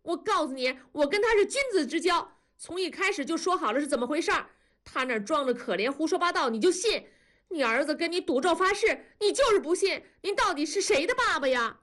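high, heated protest to his father; indignant son arguing. Acted. Son defending himself to his father, heated and high. Narrator voices furious son.

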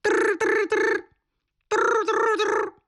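high, fast trilled ringing; rapid rolled-tongue ring in two high bursts; imitated phone ring. A narrator's reading. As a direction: trill like an old telephone bell. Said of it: "电话突然响了起".

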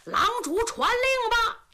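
soldiers roar it high in unison; shouting in unison. A narrator's reading. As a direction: bellow back to the commander. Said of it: "番兵番将齐声高喊".

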